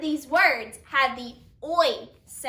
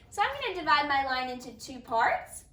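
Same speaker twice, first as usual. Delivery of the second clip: usual speaking voice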